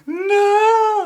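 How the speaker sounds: high, drawn-out wailing scream; hammed-up scream of grief, very loud; losing it. Owner watching pet budgie put to sleep, losing it. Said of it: "lost my mind. I was like".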